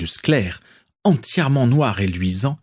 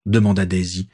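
usual speaking voice

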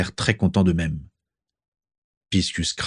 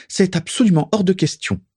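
much higher, indignant; defiant refusal; 11 semitones higher than usual. A narrator's reading. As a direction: stamp it down in revolt. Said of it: "se révolta Narcissa". (révoltée, voix très aiguë)

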